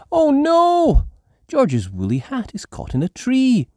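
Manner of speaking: high, loud dismayed oh no; Peppa's voice. A narrator's reading. Peppa seeing the hat caught in a tree, dismayed. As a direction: cry out at the stuck hat. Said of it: "Peppa sees hat in tree".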